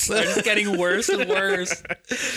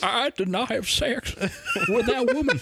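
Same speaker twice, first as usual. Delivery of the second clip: Bill Clinton impression; 5 semitones higher, slower than usual. Quoting famous denial.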